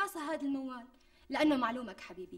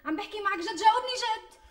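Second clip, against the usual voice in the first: rising to a high loud plea; insistent demand; 8 semitones higher, 6 dB louder than usual. Acted. Fiancée pressing her groom for a real answer, rising loud insistence.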